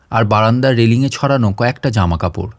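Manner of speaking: plain reading voice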